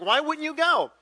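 loud, high-pitched curious question. Retold or imitated. Asking pastor about skipping the crusade, curious. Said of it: "I was just curious".

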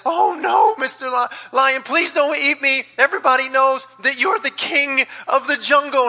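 high, loud mock panic, slowed pleas; hammed-up terror of a hyena; pleading hyena. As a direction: beg the lion for your life. Hyena begs not to be eaten.

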